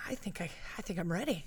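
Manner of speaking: hushed, fast whisper. Retold or imitated. Telling friends about CrossFit, nervous whisper. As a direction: breathe it out as a nervous secret. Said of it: "And I was like".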